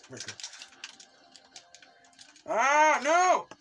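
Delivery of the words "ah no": high, loud wail of dismay; dismayed yell. Real, not acted. Player losing a round, loud dismayed yell. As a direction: yell as you fall. Falls in the game.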